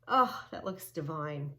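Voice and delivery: ordinary talk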